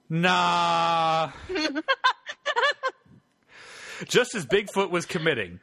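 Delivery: usual speaking voice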